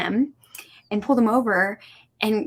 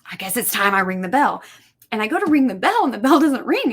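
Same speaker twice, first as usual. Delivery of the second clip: normal talking voice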